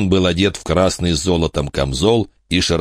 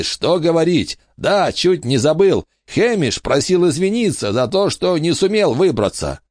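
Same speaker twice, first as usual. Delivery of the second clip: gnome king; 11 semitones higher than usual. Acted. Robbie voiced.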